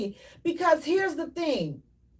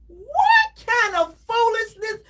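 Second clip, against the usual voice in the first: exasperated cry; 6 semitones higher, slower than usual. Real, not acted. Exasperated rant at cheaters' partners.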